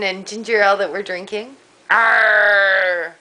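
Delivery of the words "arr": hammy pirate arr; pirate shout. Pretend. Talking like pirates.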